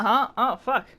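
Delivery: ordinary talk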